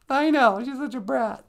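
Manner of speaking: high-pitched gossiping character; catty aside in a high put-on voice; squeaky sidekick.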